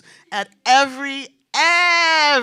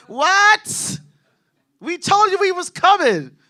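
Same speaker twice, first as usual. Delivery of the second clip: much higher, louder disbelief; outraged yell; 10 semitones higher, 8 dB louder, slower than usual. Retold or imitated. Comic retells shouting at a late friend, high outraged yell. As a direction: throw up your hands and shout it.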